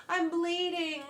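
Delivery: high, loud yelp. A narrator's reading. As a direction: cry out in alarm. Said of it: "the fox yelped".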